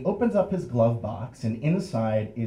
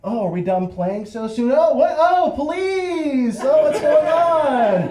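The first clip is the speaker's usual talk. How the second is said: very high, loud fake surprise; hammed-up innocence; 14 semitones higher, 7 dB louder than usual. Friend heads up the hill playing along, overacted surprise. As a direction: build from innocent question to drawn-out mock shock. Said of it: "totally plays along".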